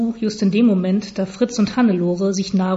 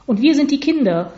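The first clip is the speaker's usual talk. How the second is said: high-pitched mild call, read aloud; lightly voiced children's call; 6 semitones higher, 3 dB louder than usual. A narrator's reading. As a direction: pipe up like two eager children. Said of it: "riefen laut Fritz und Hannelore".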